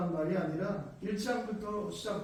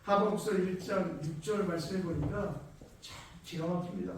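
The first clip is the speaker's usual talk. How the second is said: ordinary talk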